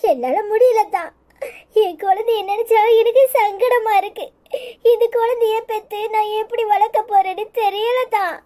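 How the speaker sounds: weeping, close to breaking down; crying. Acted. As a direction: sob out the helpless worry. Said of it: "அழாதம்மா".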